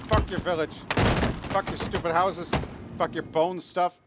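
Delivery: loud, high taunting yell; angry shouting. Pretend. Player cursing the village while attacking it, angry shouting. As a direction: curse them out in mock fury.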